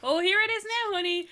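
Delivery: high, loud hammed-up visitor; excited parade tourist. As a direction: call out as a mock thrilled tourist.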